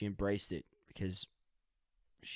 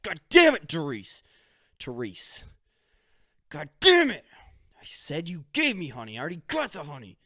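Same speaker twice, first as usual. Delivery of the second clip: hammed-up yell, much higher and louder; mock angry outburst; 7 semitones higher, 9 dB louder than usual. Husband snaps at his wife over honey, in a mock angry yell. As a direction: play-act a furious husband. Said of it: "The dude has an anger problem".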